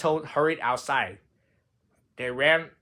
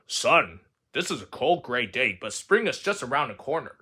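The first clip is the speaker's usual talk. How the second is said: a hint of a lower, softer father; father's voice; 4 semitones lower, 4 dB quieter than usual. A narrator's reading. Frog quoting his father, lightly lower and soft. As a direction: speak it gently like a dad.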